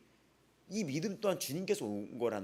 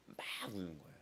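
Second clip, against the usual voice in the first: normal talking voice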